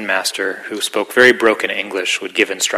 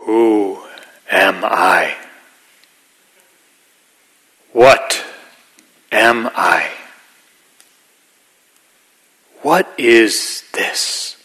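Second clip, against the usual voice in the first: slow, drawn-out, solemn questions; a hint of a Rinzai master asking in halting English; Zen master in broken English; 5 dB louder, much slower than usual. Zen master asking students in candlelit zendo, slow and solemn. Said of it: "in this very, very deep voice, this kind of broken English".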